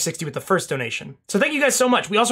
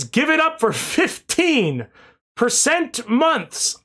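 slow; 6 semitones higher, much slower than usual. Retold or imitated. Reads chat slowly.

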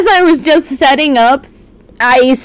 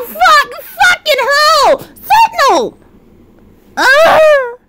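much higher, slow swearing at Sentinel; shocked outcry; 11 semitones higher, slower than usual. Real, not acted. Streamer shocked at a chatter's gift, crying out high. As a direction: cry out in shocked disbelief. Chat gifting subs, shocked.

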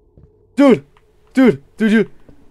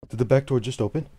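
normal talking voice

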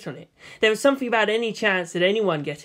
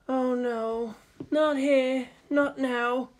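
voice breaking; 7 semitones higher, much slower than usual. Acted. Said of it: "her voice breaking".

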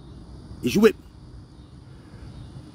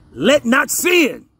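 slow, higher-pitched scripture reading; 4 semitones higher, much slower than usual. Real, not acted. Preacher reading Romans to his listeners, slowly and emphatically. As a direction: draw out each word for the class. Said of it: "I'm gonna read it slowly".

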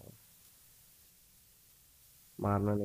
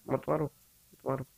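frantic begging. Real, not acted. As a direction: beg for your life. Begging sweeper not to kill.

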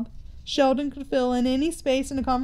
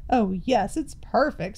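plain reading voice

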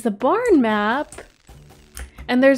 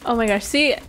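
usual speaking voice

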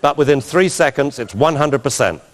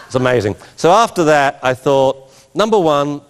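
normal talking voice

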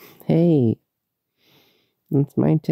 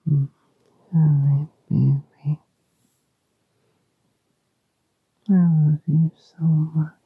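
tender breathy-free whisper. Acted. Sleepy lover whispering goodnight.

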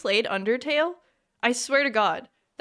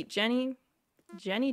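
normal talking voice

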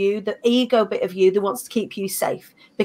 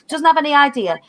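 usual speaking voice

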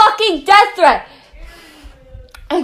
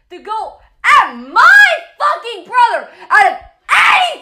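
real angry outburst, pitched up; angry shouting; 4 semitones higher than usual. Real, not acted. Defending a brother from an online attacker, angry shouting. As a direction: lash out, punching the swear words.

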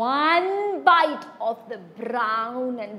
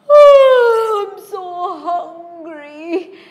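hungry whine; 5 semitones higher, 11 dB louder, slower than usual. Acted.

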